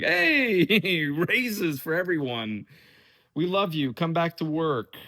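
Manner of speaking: high, hammed-up jolly announcement with a laugh; mock cheery. Companies announcing raises to everyone, mock cheery. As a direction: play a falsely generous boss, high-pitched.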